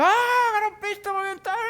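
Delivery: high, agitated neighbor shouting about his window; angry neighbor. Retold or imitated. Preacher imitating an upset neighbor yelling about the broken window, high and agitated. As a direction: play the upset neighbor, voice jumping up. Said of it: "el vecino sale exaltado".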